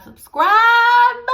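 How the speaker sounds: high, loud squealed subscribe. Real, not acted. To her viewers, a high, loud squealed subscribe. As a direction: squeal the word out playfully. Peak of outro chant.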